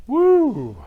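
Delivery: loud, high whoop to shake off tears; sudden emotional whoop. Real, not acted. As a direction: let out a big high "woo". Shaking off oncoming tears.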